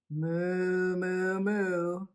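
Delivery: long drawn-out storybook moos; cow mooing. A narrator's reading. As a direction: moo like a cow for small children. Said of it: "went the cows".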